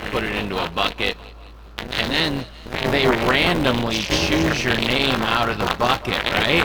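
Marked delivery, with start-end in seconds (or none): none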